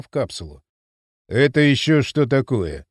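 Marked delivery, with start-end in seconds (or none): 1.3-2.9 mild surprise, slightly raised volume; understated astonished question; surprised voice. A narrator's reading. Спартак reacting to the rescued men, mildly surprised. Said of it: "донёсся удивлённый голос Спартака".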